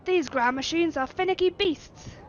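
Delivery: slow, loud attempt at Yorkshire; a shaky stab at Yorkshire; Yorkshire accent. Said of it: "that is not a Yorkshire accent".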